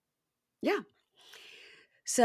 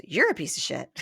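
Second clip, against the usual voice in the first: lower, amused parroting; mocking echo; 4 semitones lower than usual. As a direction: hand the insult straight back, nearly laughing. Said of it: "It's all he does is repeat it back".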